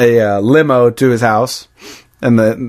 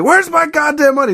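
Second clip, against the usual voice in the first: high-pitched mock angry demand; Lebowski. Angry boss demanding his money, high mock outburst. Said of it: "Lebowski's so angry".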